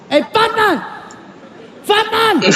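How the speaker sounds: high-pitched mock yell; yelling German man. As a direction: imitate an irate German calling out. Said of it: "he starts pointing and he's yelling".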